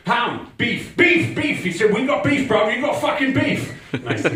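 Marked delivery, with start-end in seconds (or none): 0.1-1.6 high mock yell, building louder; retold angry shouting. Angry stranger shouting about meat, building. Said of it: "he started shouting at me". 1.9-3.7 high-pitched mock yell; retold angry shouting. Angry stranger confronting him in park, shouting. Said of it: "he started shouting at me".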